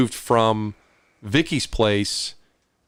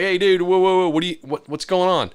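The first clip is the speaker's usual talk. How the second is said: retold alarmed protest; 6 semitones higher than usual. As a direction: pitch up, quick, alarmed calming. Startled by cop with gun.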